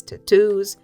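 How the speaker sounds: plain reading voice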